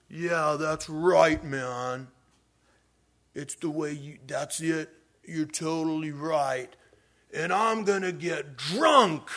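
put-on slow, dragged-out drunk voice; drunk man. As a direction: agree like a happy drunk, stretching the words. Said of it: "they were already ripped, drunk, bad".

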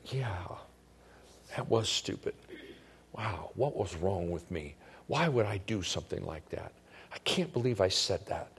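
low, soft self-berating, as if thinking aloud; self-reproach. Acted. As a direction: beat yourself up under your breath, acted. Acting out our self-condemning thoughts.

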